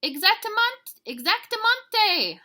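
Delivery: high, loud, second word drawn out; howling doggies. Acted. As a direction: howl it like a pack of little dogs. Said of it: "howled the doggies".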